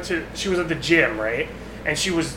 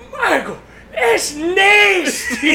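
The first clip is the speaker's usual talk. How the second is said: high, slow, laughing yell; laughing shout; 10 semitones higher, 4 dB louder, much slower than usual. Retold or imitated. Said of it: "He goes".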